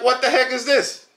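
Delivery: high, incredulous mock outburst; shocked wife. Imitating his wife opening the fake shoes, high and incredulous. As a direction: play the wife gaping at the cheap shoes. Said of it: "she's like".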